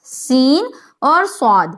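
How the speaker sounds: ordinary delivery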